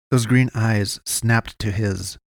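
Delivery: ordinary delivery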